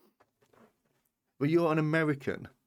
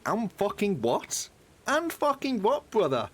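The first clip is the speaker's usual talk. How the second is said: defiant mock outrage; 8 semitones higher, 3 dB louder, slower than usual. Real, not acted. Defending bell peppers in bolognese.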